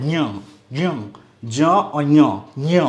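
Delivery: ज्ञ said as jnya, ज joined to a nasal ञ; ज्ञ as ज plus ञ. Shown as a demonstration. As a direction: sound ज्ञ like ज्यों with a nasal ञ, not gya. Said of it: "क्या उच्चारण आ रहा है". (ज्ञ को ज और ञ मिलाकर, ज्यों जैसा बोलें)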